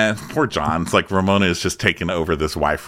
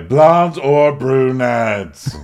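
very slow, stretched, louder question; drawn-out insistence; 4 semitones higher, 5 dB louder, much slower than usual. Retold or imitated. Kenya repeating her demand to John, drawn-out insistence. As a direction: drag out each word in a raised voice.

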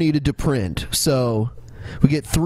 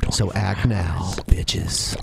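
whispered sign-off; 7 semitones lower, much slower than usual. Acted. Mock ad sign-off.

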